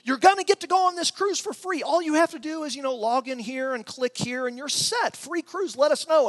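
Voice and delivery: high, bright mock sales pitch; hyped cruise pitch. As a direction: ham up the too-good-to-be-true ad. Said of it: "they were saying".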